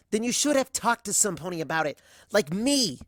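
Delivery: loud, high, angry shout; a shout that cracks upward at the end. Acted. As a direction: yell it at her, hurt and furious. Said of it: "I shout".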